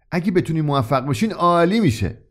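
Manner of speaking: courteous, even compliment on «عالی»; polite. Acted. Young customer politely encouraging the shopkeeper. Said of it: "مشتری جوان مؤدبانه گفت".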